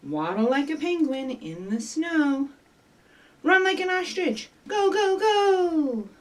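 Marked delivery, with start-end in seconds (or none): 3.4-6.1 excited urging. Acted. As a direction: cheer the little runners on. Urging children to run like ostriches.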